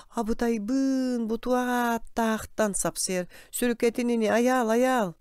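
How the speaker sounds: high, drawn-out cry of alarm; agitated high-pitched calling; acted exclamation. Character exclaims and calls Аял.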